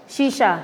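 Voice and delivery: शीशा said with clear sh sounds; correct shisha. Shown as a demonstration. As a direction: say शीशा as "shee-shaa", both sh. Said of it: "वो सही शब्द होता है".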